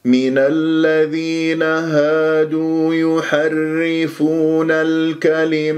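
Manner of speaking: slow, melodic recitation of the verse; measured tajweed recitation; Quran recitation. Shown as a demonstration. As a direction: chant slowly, stretching the long vowels.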